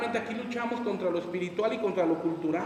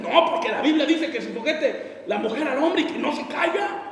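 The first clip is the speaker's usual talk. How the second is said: higher, louder self-justifying husband; self-righteous husband; 5 semitones higher, 5 dB louder than usual. Retold or imitated. Husband justifying himself with the Bible, self-righteous. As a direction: argue like you have scripture on your side.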